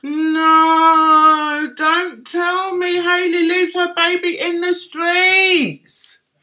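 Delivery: high, drawn-out protest; shocked disbelief. Real, not acted. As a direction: stretch it out in scandalised disbelief. Mock shock at viewer's gossip.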